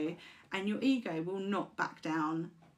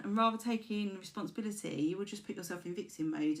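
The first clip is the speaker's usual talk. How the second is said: ordinary delivery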